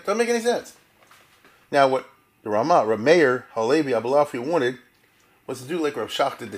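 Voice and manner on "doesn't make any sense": scoffing. Real, not acted. Mocking the quoted view.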